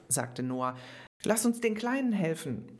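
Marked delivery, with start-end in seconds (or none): 1.2-2.7 higher, eager call to a friend; rally your friend to help. A narrator's reading. Zoe calling to Noah amid the chaos, eager. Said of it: "rief Zoe".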